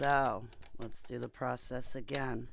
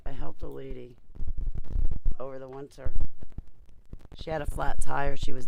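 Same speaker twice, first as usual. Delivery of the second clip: louder into the mic; 12 dB louder than usual. Real, not acted. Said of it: "I gotta speak into the mic".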